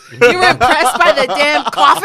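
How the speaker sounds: high, loud, laughing incredulous question; cracking up while teasing mom about coffee; laughing disbelief. Retold or imitated. Daughter laughing at her mom's coffee awe, loud and high.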